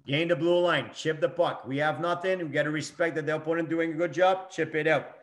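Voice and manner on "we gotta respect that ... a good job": normal talking voice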